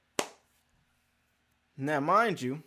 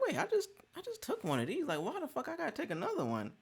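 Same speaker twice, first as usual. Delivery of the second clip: inner thought; 10 semitones higher, 9 dB quieter, faster than usual. Retold or imitated.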